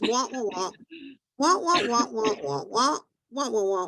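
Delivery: droning wah wah like a muted trumpet; Charlie Brown adult voice. Retold or imitated. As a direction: mimic noise with no words, sing-song wahs. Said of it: "Charlie Brown, how I listened".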